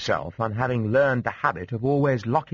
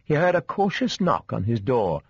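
usual speaking voice

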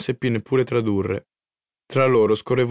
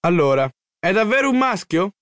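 higher, insistent excited call; raised, high-pitched eager shout; yelling to be heard; 7 semitones higher than usual. A narrator's reading. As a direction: call out the question to get attention. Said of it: "urlai per farmi sentire".

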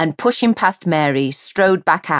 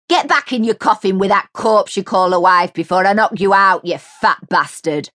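loud, menacing abuse; threatening rant; 4 semitones higher, 4 dB louder than usual. A narrator's reading. Mary threatening the neighbour, menacing. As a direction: threaten him to his face.

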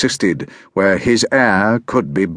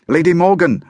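loud, high call across the snow; shouting; 9 semitones higher, 3 dB louder than usual. A narrator's reading. Boy running to the hovel, shouting for Morgan. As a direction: call her name from afar, loud and high. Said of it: "I shouted as I neared the hovel".

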